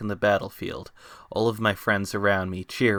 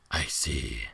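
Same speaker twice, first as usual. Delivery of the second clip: very low, distant beast voice; deep monster voice; 9 semitones lower, 8 dB quieter than usual. Acted. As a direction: speak as a huge ancient beast. Beast answers.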